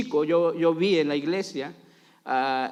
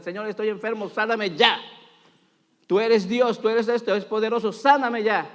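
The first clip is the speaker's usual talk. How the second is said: higher, insistent demand to God; demanding prayer; 4 semitones higher than usual. Acted. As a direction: act out an impatient plea, pushing harder. Acted demanding prayer for instant healing.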